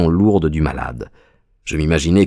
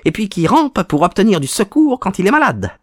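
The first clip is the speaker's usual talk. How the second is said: high-pitched, mocking villain; gloating visitor; 11 semitones higher than usual. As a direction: taunt the sick man in a high voice. (visiteur moqueur à la voix aiguë)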